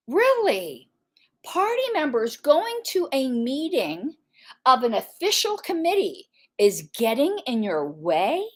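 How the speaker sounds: usual speaking voice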